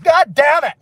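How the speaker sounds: high, loud curse; frustrated shout. Real, not acted. Cursing after straining at sheep bleats, loud frustrated shout. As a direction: burst out in exasperation.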